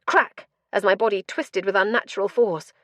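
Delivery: ordinary delivery, nothing marked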